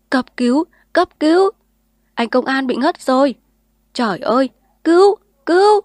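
frantic cries for an ambulance; calling for help. Acted. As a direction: scream for help. Crowd calling for emergency help.

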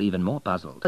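usual speaking voice

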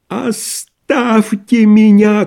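high, drawn-out furious cry; frenzied shout. A narrator's reading. Doctor drives off his visitors, frenzied shout. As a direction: shout as if beside yourself. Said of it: "крикнул он не своим голосом".

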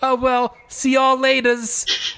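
high, hammed-up show host farewell; in-character sign-off. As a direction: toss off a goodbye in a high put-on voice. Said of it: "And at the end, is like".

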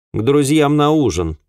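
high, cutting woman's reply; curt retort. A narrator's reading. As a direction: cut off the questioner sharply. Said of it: "отрезала миссис Гриффитс".

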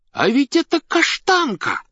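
put-on high, loud, excited child's voice; child's shout. A narrator's reading. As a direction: cry out in a child's piping voice. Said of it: "крикнул детский голос". (звонкий детский выкрик)

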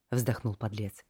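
ordinary delivery

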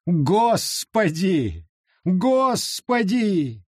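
slow, rising wail of terror; wailing sobs. Acted. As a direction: beg heaven in terror. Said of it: "зарыдал в голос".

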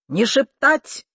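high, loud, slow command; shouting. A narrator's reading. Deaf aunt from the kitchen, shouting. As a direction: yell the order from another room. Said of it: "крикнула из кухни".

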